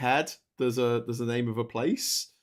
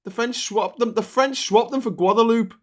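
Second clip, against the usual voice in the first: higher and louder, worked-up telling; ranting happily about history; excited; 7 semitones higher, 6 dB louder than usual. Real, not acted. Retelling the Seven Years' War to a friend, excited.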